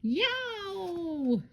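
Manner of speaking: long, very high storybook yelp; yelled. A narrator's reading. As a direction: draw out a high squeal of alarm. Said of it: "she yelled".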